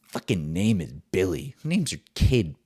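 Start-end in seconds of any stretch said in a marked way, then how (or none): none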